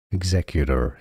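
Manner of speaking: "Executor" ending in a clear American R; American "Executor", ending "-der" with the R heard; American pronounced R. Shown as a demonstration. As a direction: say "Executor" with flap T and sounded R. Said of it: "the R is pronounced in American English".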